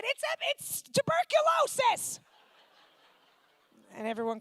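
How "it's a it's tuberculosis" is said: much higher, bright fake reassurance; comic cheery lie; squeaky claim. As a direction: chirp it innocently. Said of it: "And I said".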